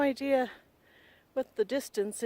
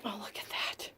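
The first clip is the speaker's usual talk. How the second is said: whispering; 3 semitones lower, 9 dB quieter than usual. Real, not acted. Awed at the view.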